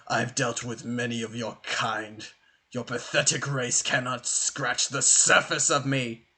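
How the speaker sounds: low, soft, disdainful villain voice; scornful villain. Acted. Game master as Leroy sneering at Mino, lofty contempt. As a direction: look down on a puny foe, quietly and low.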